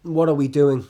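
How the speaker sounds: exasperated question. Acted. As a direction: ask it out loud, fed up. Exasperated question before quieter repeat.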